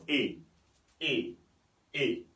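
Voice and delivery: "E" repeated as the English name of A, separated by pauses; English letter name. Shown as a demonstration. Teacher drilling the first vowel, English letter name. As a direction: say "E" like the vowel in "day", one at a time. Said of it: "nous avons E en première position".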